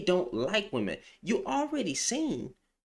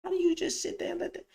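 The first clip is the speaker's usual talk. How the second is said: incredulous. Retold or imitated. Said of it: "I'm like".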